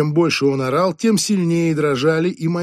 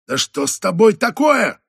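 loud, higher exasperated snap; angry yell; 7 semitones higher, 5 dB louder than usual. Acted. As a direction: blow up at the clumsy kid. Boss yells at waiter.

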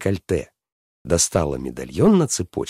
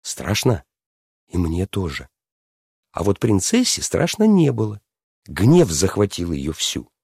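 ordinary delivery

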